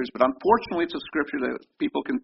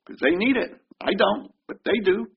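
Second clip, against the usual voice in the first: smug call-out; 8 semitones higher than usual. Retold or imitated. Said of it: "we can say".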